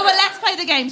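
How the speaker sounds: ordinary talk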